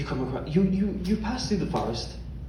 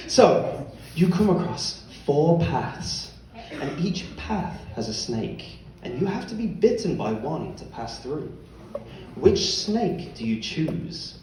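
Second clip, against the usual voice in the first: slow, soft scene-setting; game master narration; 4 semitones lower, 5 dB quieter, slower than usual. Acted. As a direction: pose the riddle slowly, with measured pauses.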